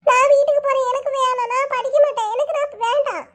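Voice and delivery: high tearful tantrum; crying. Acted. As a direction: cry and refuse, pitched high. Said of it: "என்ன இப்படி அழுறான்?". (உயர்ந்த குரலில் அழுகையோடு அடம்)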